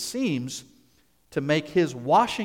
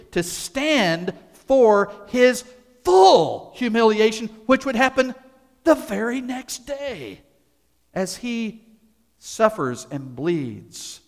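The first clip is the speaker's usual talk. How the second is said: high, loud, slow fervent preaching; preaching climax; 7 semitones higher, 6 dB louder than usual. Acted. Preacher building to the cross, loud fervent climax.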